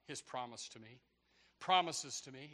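normal talking voice

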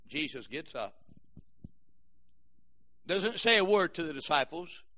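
normal talking voice

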